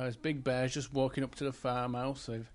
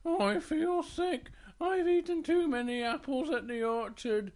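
poorly Little Bear; 10 semitones higher than usual. Acted. Little Bear after too many apples, feeling sick. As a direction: whine as a queasy little bear. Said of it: "Little Bear goes".

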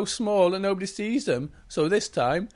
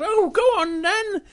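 very high, louder tiny-bear voice; Little Bear; 6 dB louder than usual. Acted. Little Bear urges the princess, high and loud. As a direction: urge her on in a high voice. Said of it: "and Little Bear goes".

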